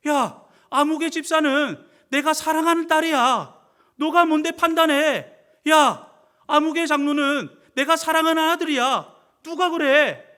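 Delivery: high, loud preacher's challenge; forceful protective rebuke. Acted. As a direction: call out the accusers on your child's behalf. God scolds those judging his children.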